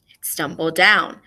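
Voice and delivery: ordinary talk, nothing marked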